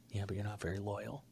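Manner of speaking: low, very soft imitation; hushed mock sulk; sullen quiet retort. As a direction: drop right down, low and barely voiced. Acts out Ash's hurt retort.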